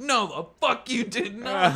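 loud, high, slow mock bellow; shouted contradiction. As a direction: imitate an irate drill instructor. Drill instructor shouts down recruit.

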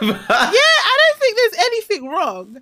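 high, loud laughing speech. Real, not acted. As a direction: talk through big laughter. Laughing admission.

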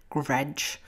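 low, savoring guttural growl of a word. Shown as a demonstration. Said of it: "It sounds nasty and guttural".

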